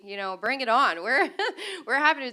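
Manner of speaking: laughing through the words, upbeat. Real, not acted. Lawmaker laughing as she tells the panel they're ready. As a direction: let a laugh break into it.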